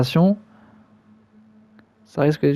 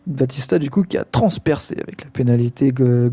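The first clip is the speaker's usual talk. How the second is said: ordinary delivery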